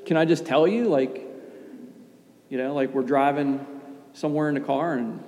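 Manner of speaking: ordinary talk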